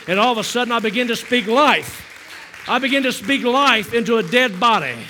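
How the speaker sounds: rising preaching. Acted. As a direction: drive the words home with fervor. Preaching climax.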